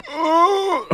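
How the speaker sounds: disgusted ugh. Retold or imitated. Disgust at leech while pulling.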